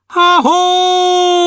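high, loud hallo to the sky; shouted call. Acted. Dwarf hailing the stars, high shout. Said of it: "kiabálta Kökőszi".

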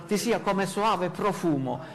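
ordinary talk

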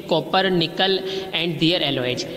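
ordinary delivery

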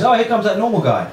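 lightly put-on voice of someone spotting him; mock onlooker. People dreading the normal guy's arrival, mock onlooker.

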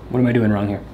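ordinary delivery

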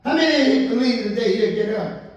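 fast, rising preacher's call; preaching at full force. Acted. Preacher calls to congregation.

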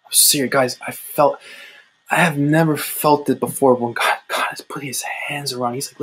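usual speaking voice

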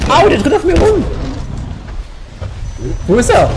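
high, loud panic; shrill real alarm as the attack lands; panicked yelp. Real, not acted. Player under attack crying out to teammates, high panicked yelp. Monster attacking him in game.

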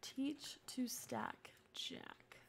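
slow breathy whisper. Real, not acted. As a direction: whisper it slowly to yourself. Whispering a Sim's task aside.

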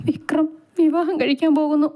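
high, halting crying voice; tearful sobbing. A narrator's reading. Vaidu breaks down telling Chandu about Vikram, acted tearful sobbing. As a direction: act it out as if stammering through sobs. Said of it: "വൈദു പൊട്ടിക്കരഞ്ഞുകൊണ്ട്".

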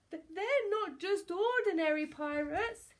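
high, halting alarm; frightened stammer. A narrator's reading. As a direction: stutter on the first word. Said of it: "he stammered".

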